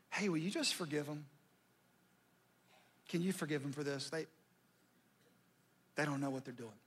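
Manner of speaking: hushed, gentle asking for forgiveness; tender quiet prayer. Acted. Jesus on the cross asking Dad to forgive, softly. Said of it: "I said".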